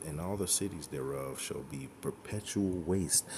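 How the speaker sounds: a hushed, measured reading of the verse; scripture reading. Acted. Preacher reading Jeremiah to his listeners, hushed and solemn. Reads scripture aloud quietly.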